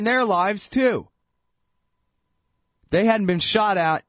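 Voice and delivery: high, indignant rant; outraged. Real, not acted. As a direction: rise into outrage. Indignant rant about the press.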